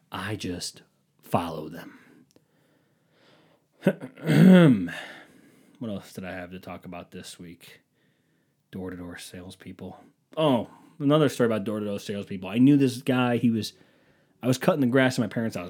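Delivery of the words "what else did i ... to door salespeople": low, very soft thinking aloud; hushed note to self; talking to himself. Real, not acted. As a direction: murmur it while recalling your list. Recalling his topics, talking to himself.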